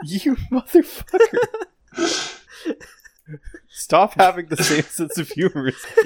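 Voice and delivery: high mock insult through laughter; fake-angry while still giggling; laughing mock outrage. Real, not acted. Scolding his joke-stealing friend, laughing.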